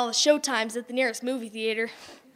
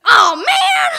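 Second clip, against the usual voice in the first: high, loud whiny yell; disappointed shout; 13 semitones higher, 11 dB louder than usual. Acted. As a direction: groan it out loud. Lights go out.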